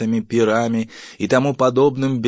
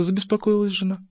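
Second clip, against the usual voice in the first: usual speaking voice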